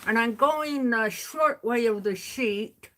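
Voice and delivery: talking with pencil between teeth; pen in teeth, words muffled; pencil in mouth. Real, not acted. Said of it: "put my pencil in my mouth".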